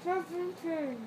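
mushy words round a mouthful; cheeks stuffed full. Real, not acted. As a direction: mouth crammed with marshmallows.

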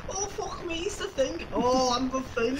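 very high, hammed-up Scouse; Scouse accent. The Thing with everyone Scouse, high comic accent. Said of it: "The thing, but everyone's Scouts".

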